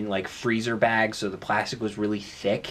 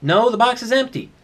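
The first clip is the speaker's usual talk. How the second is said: insistent reply; 11 semitones higher, 6 dB louder than usual. Acted. Acted quarrel with roommate.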